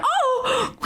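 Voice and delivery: high-pitched "oh!" with a laugh; squealed "oh!", high and amused; startled mock squeal. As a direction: imitate her surprised little yelp. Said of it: "she went".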